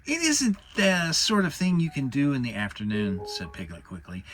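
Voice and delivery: squeaky Piglet inventing a reason to leave; nervous Piglet. Acted. Narrator voices Piglet.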